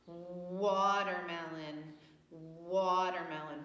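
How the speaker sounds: "Watermelon" said slowly, each syllable drawn out; slow stretched word. Shown as a demonstration. Teacher to class, modelling the word slowly. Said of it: "first sound you hear".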